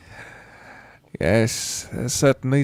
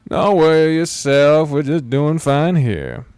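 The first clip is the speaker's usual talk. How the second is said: louder, slower and easygoing; hearty traveller; 4 semitones higher, 7 dB louder, slower than usual. Acted. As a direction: brush off the apology with a big friendly voice.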